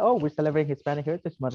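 usual speaking voice

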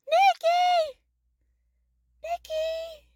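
high, long cry of the name; calling her younger self, high and drawn out. Pretend. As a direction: call out searching. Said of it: "I'm calling my younger self".

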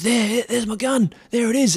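imitating a mate spotting his gun; excited shout. Mate spots his floating gun from the boat, excited call. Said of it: "he goes".

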